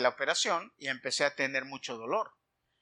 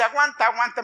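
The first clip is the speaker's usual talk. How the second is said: louder, higher retold urging; brisk, raised "aguanta, aguanta"; urging to endure; 6 semitones higher, 7 dB louder than usual. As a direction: imitate someone insisting you bear it. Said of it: "me dice".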